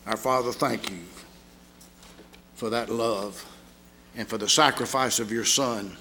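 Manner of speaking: low, earnest prayer voice. Acted. Preacher closes the sermon praying for the congregation, earnest. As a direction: lead the church in prayer.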